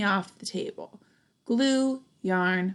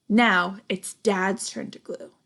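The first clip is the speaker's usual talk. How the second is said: plain reading voice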